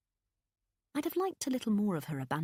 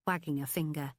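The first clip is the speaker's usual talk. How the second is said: ordinary delivery, nothing marked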